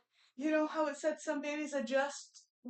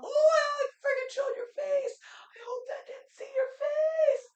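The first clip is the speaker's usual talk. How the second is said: much higher and louder, alarmed; real startled shriek; 12 semitones higher, 6 dB louder than usual. Real, not acted. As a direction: squeal it out in sudden panic. Realizes she showed baby's face.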